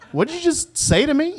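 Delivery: what did you just say, half laughing; incredulous wife. Retold or imitated. Wife incredulous at grandparent names.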